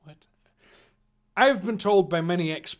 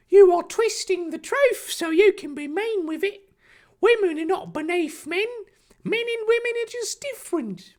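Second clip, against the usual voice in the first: very high put-on cartoon voice; earnest protest; 3 dB louder than usual. Harry defending women to Draco, earnest high put-on voice. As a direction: argue back in a silly high-pitched voice.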